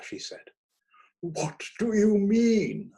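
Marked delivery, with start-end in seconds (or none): 1.2-2.9 vocal italics. A narrator's reading. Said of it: "whispering in vocal italics".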